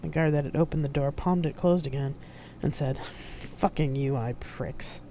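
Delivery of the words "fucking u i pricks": muttered curse. A narrator's reading. Guard muttering in video.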